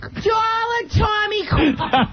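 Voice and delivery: shouted Massachusetts mom calling out; broad Massachusetts yell, stretching each word; Massachusetts accent. Acted. Massachusetts mother hollering across the house. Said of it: "How do you say C A U L K in Massachusetts".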